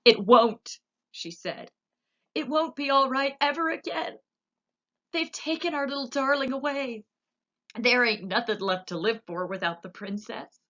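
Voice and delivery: high, pained, near-sobbing cook; a touch of weeping in a high voice; tearful cook. A narrator's reading. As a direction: protest through tears.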